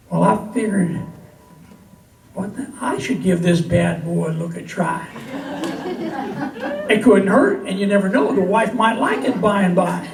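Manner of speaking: lively, lilting comic verse for a crowd; cowboy poetry recital. Acted. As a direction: tell it as a rhyming yarn from the stage. Recites comic cowboy poem to audience.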